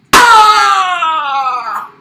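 loud high scream then gasp; high battle scream. Pretend. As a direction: scream into the fight. Fight clash cry.

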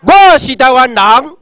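shouted slowly and high; loud declaration. Shown as a demonstration. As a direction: proclaim it to the crowd. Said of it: "大声讲".

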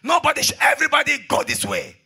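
high, loud traffic-marshal command; shouted order. Acted. Minister in charge of traffic to his boys, shouted order. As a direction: shout it to direct the whole lot. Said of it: "I told my boys".

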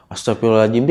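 plain reading voice

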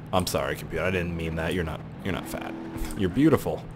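low, hushed mock-sincere apology; soft apology. Apologizing to insulted computer, soft and low.

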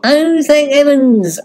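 a high slow sigh of relief; relieved that it was a joke. Acted. Relieved after being teased.

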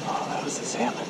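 a hushed whisper so the fish aren't scared. Real, not acted. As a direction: whisper as a salmon passes. Spotting salmon in the water.

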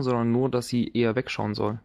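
ordinary talk

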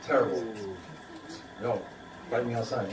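normal talking voice

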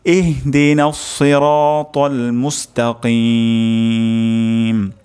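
slow, drawn-out melodic recitation; Quran recitation. Shown as a demonstration. Teacher recites the full verse melodically. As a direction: chant the verse with long held vowels. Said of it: "আরেকবার তেলাওয়াত করি".

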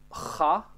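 "Ha" with scraping friction at the top of the throat; guttural "Ha", like a hard kh; throaty H. Shown as a demonstration. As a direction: rasp the H in "Ha". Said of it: "se pronunță litera H".

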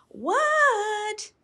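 high yelled answer; yelling back. A narrator's reading. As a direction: shout back from the snow. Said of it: "yelled Froggy".

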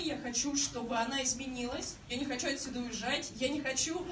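usual speaking voice

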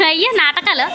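teasing. Real, not acted. Mother teases toddler's drama.

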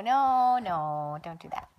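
usual speaking voice

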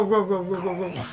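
normal talking voice

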